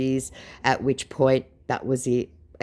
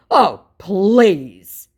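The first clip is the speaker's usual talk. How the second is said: high, scornful brush-off; mock derision; 6 semitones higher, 10 dB louder than usual. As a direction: sneer it out as the scammer. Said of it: "I could feel the derision".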